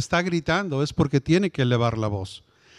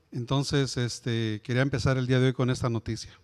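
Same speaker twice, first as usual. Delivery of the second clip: lower, softer, heavy-hearted delivery; grave, somber news; 4 semitones lower, 3 dB quieter than usual. Shown as a demonstration. Supervisor telling crew about coworkers killed, somber and soft. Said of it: "¿He robado la atención de ustedes?".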